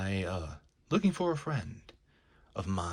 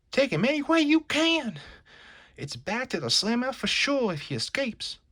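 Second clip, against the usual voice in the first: excited yell; 13 semitones higher, 3 dB louder than usual. Acted. Goon urging the gang on.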